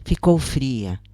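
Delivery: ordinary delivery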